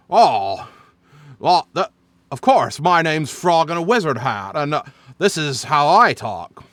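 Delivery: aw-shucks frog in a wizard hat; Jimmy Stewart voice. Shown as a demonstration. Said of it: "Jimmy Stewart from, uh, uh, s-, movies like".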